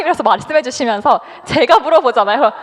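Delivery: ordinary delivery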